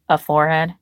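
low deadpan question; understated low deadpan. A narrator's reading. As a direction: drop it flat and low. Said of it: "he deadpanned".

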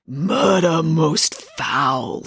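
extremely slow, drawn-out ghost voice; slow, doom-laden ghostly pronouncement; ponderous ghost. A narrator's reading. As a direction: intone it, stretching every vowel. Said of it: "in a slow, ponderous voice".

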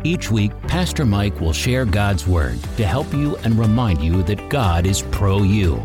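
ordinary delivery

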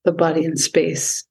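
calm, unhurried meditation voice with long pauses; guided meditation. Acted. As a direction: guide the listener gently into stillness. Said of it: "lead us through any sort of meditation".

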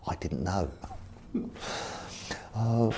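ordinary talk

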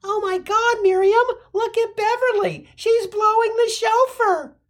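very high gossiping lady voice, mock-shocked; hammed-up high-pitched gossip; gossiping ladies. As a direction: squeal it like a scandalized guest at a wedding. Bridesmaid gossip voiced in joke.

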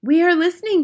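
high, breathless-to-hear witch chorus; excited followers answering their leader; eager cry. A narrator's reading. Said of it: "cried the audience".

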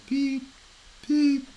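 high-pitched electronic beeps, spaced apart; two high, separated alarm pips; imitated monitor beep. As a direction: mimic a little heart monitor beeping. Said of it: "her little watch going".

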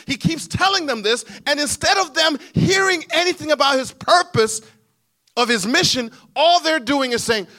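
high, loud sermon delivery; impassioned preaching. Real, not acted. Preacher telling what the disciples had heard, high impassioned preaching. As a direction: preach it out at full force.

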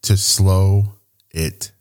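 drawn-out, x0.4 slow words; slowed-down delivery. Shown as a demonstration. Host slowing his speech as he says slow it down. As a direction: stretch each word, slowing it right down. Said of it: "speak a little slower".

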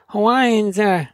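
whiny complaint. Retold or imitated. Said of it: "they're like".

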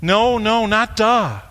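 ordinary delivery

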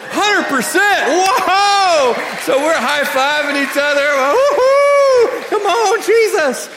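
high, full-voiced celebratory shouting; wildly excited yell; ecstatic cheering. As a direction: whoop like the team just won. Table celebrates the healing.

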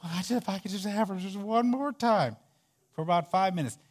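mock pleading mourner.

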